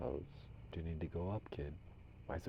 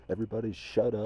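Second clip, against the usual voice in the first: louder, sharper everybody shut up; loud command; 4 semitones higher, 9 dB louder than usual. Real, not acted. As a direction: snap it at the crowd of voices. Yells at the spirit voices.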